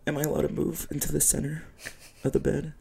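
high, hesitant timid request; nervous guest. Acted. Guest asking to move on someone's bed, mock nervous. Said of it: "you're all nervous".

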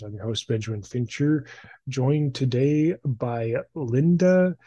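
usual speaking voice

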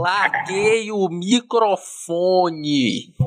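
extremely slow, dragged syllables. Pretend. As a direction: drag it out even longer. Repeated mic drop line.

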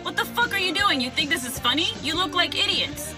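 angry. Acted. Girl yelling at dressed-up boys.

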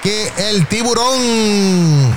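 announcer drawl. Acted. Host introducing the caller on air, slow rising announcer call. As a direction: stretch tiburón out slowly like a ring announcer.

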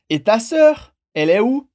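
"Et ta sœur, elle est où" with pitch climbing on sœur and on où; rising question intonation. Shown as a demonstration. French teacher modelling the dialogue's question, rising intonation. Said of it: "preste atenção na entonação, porque ela sobe".

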